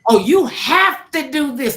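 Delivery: high, loud, slow retold insistence; insistent demand. Producers insisting she read it.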